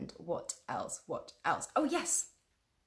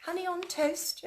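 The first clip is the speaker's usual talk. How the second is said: plain reading voice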